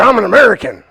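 retold defiant patriot cry. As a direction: proclaim it like a stubborn patriot. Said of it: "And I was like".

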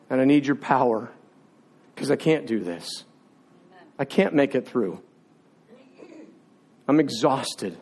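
low, soft, defeated plea; exhausted prayer. Retold or imitated. Preacher re-enacting surrender to God, exhausted pleading. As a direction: drop low and spent, pausing between admissions. Spent prayer after the outburst.

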